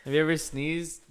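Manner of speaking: ordinary delivery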